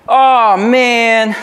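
high, loud, drawn-out "oh man"; mock congregant reaction. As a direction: act out an imagined listener's big reaction. Congregant's imagined reaction to topic.

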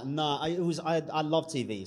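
ordinary talk

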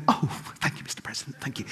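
hushed, absent-minded thanks; mock stunned. Guest who hasn't listened to the president, mock stunned. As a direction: murmur it automatically, imitating a stunned guest. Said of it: "they were a bit stunned".

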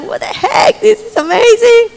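high, astonished cry of wonder; amazed. Retold or imitated. Healed man marvelling aloud, high amazed cry.

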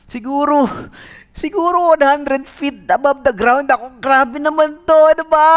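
high, loud, frantic panic; scared hanging from a branch; panicked. Retold or imitated. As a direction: gasp it out dangling over the drop. Jogger dangling over cliff.

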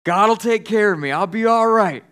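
acted shout up to a helicopter; shouting back. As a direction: holler back a refusal of help. Stranded man shouts back to helicopter.

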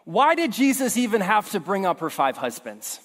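usual speaking voice

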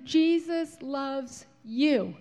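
very slow, each word stretched out like letters in the sky; drawn-out skywriting words. Acted. Reading the skywriter's message aloud, very slow and drawn out. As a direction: read it slowly, as if it is being written overhead.

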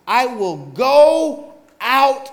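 very slow, drawn out; slow ominous spirit. Acted. Lying spirit slowly offering to the Lord. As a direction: stretch every word like a menacing spirit.

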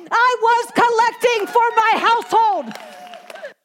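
climactic preaching cry. Acted. Preacher hitting the refrain's peak to the church, drawn-out cry. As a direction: stretch the line out like a preacher's peak.

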